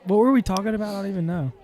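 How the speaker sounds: normal talking voice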